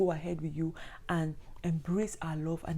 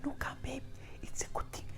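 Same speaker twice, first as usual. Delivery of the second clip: hushed, soothing near-whisper; calm her down softly, almost whispering; soft reassuring hush; 9 semitones higher, 7 dB quieter than usual. Acted. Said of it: "Darren is quick to hold her back".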